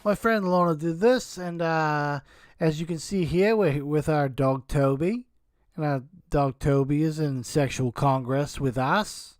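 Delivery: light put-on Australian accent, mocking the wife's words. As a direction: parody her in a loose Australian accent. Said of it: "kind of an Australian accent there".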